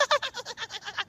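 a shrill, goofy guffaw imitating a customer; high goofy laugh. Barber mimics the laugh before he enters. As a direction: mimic the regular's distinct cackle. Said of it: "I'd just be like".

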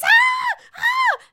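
high shrieking ahs; mock screaming. Wendy screaming at the proposal, shrill ahs. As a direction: shriek like an overexcited fan. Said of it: "getting up in their faces".

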